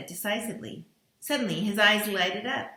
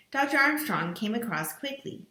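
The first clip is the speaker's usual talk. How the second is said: usual speaking voice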